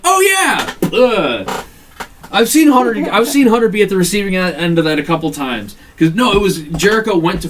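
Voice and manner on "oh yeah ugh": high, louder mock pained "Oh, yeah!"; imitating Triple H's sell. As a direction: ham up a fake hit reaction. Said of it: "Triple H is like".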